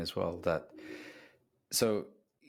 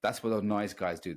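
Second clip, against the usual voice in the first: ordinary talk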